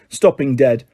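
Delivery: plain reading voice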